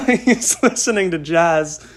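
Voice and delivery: loud, amused, real laugh running into the words. Real, not acted. Telling listeners what dad plays on the porch, laughing.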